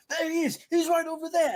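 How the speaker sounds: high, loud shout; bandit's alarm call. Acted. Bandits spotting a runner heading for the trees, alarmed shout. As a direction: call out the sighting to the others. Bandits spot the fleeing player.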